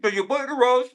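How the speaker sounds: talking while chewing; mouth full. Real, not acted. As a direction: say it through a mouthful of food. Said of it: "Stop with your mouth full".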